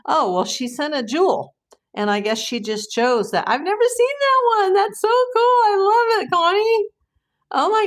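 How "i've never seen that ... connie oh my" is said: very high, louder, gushing delight; real gushing squeal of thanks; thrilled squeal. Real, not acted. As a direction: squeal your thanks to a friend. Thrilled by viewer's gift.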